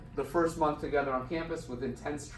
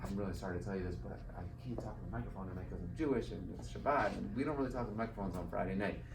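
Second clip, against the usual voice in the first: hushed, much softer, into the director's ear; retold whisper; 5 semitones lower, 9 dB quieter, faster than usual. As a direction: lean in and say it softly, like an apology. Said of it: "I whisper into his ear".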